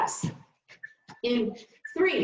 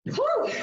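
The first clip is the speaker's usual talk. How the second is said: relieved exhale; 12 semitones higher than usual. Real, not acted. Exhaling after hard interval.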